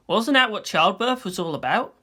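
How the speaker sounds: plain reading voice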